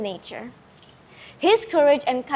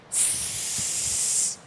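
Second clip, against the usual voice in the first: a soft, breathy serpent's hiss; 10 dB quieter than usual. Acted. Giant serpent hisses before threatening the world, sibilant. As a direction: hiss like a snake. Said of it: "Its hiss".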